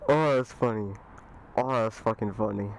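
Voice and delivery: usual speaking voice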